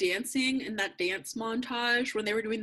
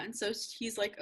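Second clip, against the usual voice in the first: ordinary talk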